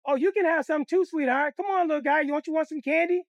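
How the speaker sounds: high, sugary coaxing stranger voice; sweet candy lady. Retold or imitated. Stranger in a car luring kids, high sugary coax.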